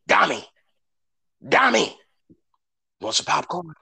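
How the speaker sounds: high, hushed "Dami, Dami"; mock dad offering popcorn too late; imitating dad. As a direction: whisper the offer like a dad leaning over. Dad whispering offer mid-movie.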